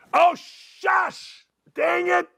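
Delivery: high, flustered catch of himself; flustered yelp. Real, not acted. Host catching his own slip, flustered yelp.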